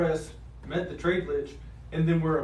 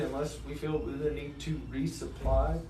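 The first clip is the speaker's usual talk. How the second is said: usual speaking voice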